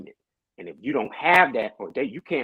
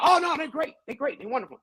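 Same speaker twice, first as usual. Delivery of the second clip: mock gushing defense; 12 semitones higher than usual. Hypocrite vouching for a new partner, mock gushing. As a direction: swear it up and down in a high, insincere voice. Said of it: "we'll swear up and down".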